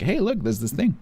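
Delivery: high, eager show-off voice; playfully flaunt the new item; mock show-off. Streamer showing off a new camo to viewers, high eager mock brag. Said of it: "So that I can go like".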